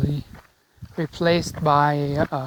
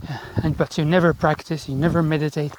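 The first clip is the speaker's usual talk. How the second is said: ordinary talk, nothing marked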